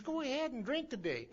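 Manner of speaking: voicing Frank as he turns down the request; a lifted, knowing Frank voice; Frank's voice. Frank's advice quoted.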